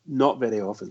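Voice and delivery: dropped to a quiet rueful mutter; muttered repeat. Real, not acted. Pundit repeating himself quietly in dismay.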